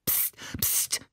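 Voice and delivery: soft, whispered shushing; hissed hush. A narrator's reading. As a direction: hiss for silence under your breath. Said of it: "zischte Ali".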